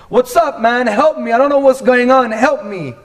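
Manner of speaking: high, loud, pleading like to a buddy; casual plea to God. Retold or imitated. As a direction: call out to God as if he's right in front of you. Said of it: "treating God like he's just like".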